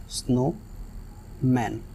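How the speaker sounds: Snowman drawn out slowly and softly as snow... मैन; split into syllables. Shown as a demonstration. Teacher demonstrating the syllable split to the class, slow and soft. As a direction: pull snowman apart at the syllable break. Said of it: "एक अलग word है और snow एक अलग word है".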